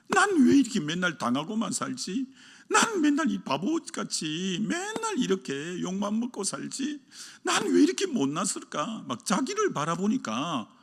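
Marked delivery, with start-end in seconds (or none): 0.1-9.0 self-pity. Retold or imitated. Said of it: "자괴감이 들어서".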